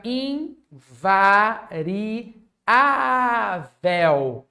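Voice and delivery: invariável very slow, syllable by syllable, with A held long; invariável in separate beats, swelling on the stressed Á; drawn-out key word. Shown as a demonstration. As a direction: stretch invariável into in-va-ri-Á-vel. Teacher stressing the grammar rule.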